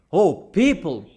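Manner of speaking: usual speaking voice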